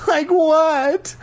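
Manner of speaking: very high, upset demand; trembling voice. A narrator's reading. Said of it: "demanded in a trembling voice".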